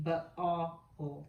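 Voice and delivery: b, a, o said apart, slowly; slow sound-out for kids; segmented sounds. Shown as a demonstration. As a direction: stretch each sound. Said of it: "What word am I saying?".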